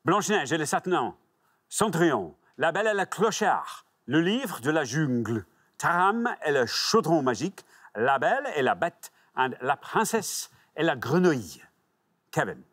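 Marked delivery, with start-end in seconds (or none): 0.1-9.1 lilting French titles read as a list; relished French pronunciation; French accent. Acted. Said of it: "a French accent, though, don't you". 9.6-11.6 lilting French flourish to end the list; showy French pronunciation; French accent. Acted. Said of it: "a French accent, though, don't you".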